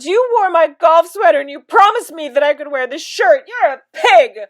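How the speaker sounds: high, loud bawling complaint; sobbing. A narrator's reading. Mother accuses father over the shirt, hammed-up sobbing. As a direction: wail it like a tantrum. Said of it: "and she sobbed".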